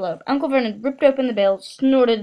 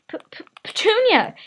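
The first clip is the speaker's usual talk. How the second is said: stammering higher gasp of the name; 11 semitones higher, 4 dB louder than usual. A narrator's reading. Uncle gasping his wife's name, shocked stammer. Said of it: "he gasped".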